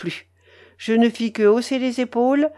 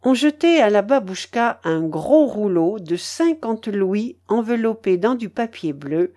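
usual speaking voice

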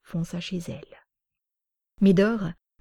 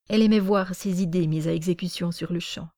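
ordinary delivery, nothing marked

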